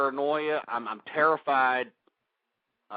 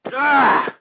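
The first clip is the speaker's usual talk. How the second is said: panicked yell; 11 semitones higher, 13 dB louder than usual. Real, not acted. Host panicking after getting high.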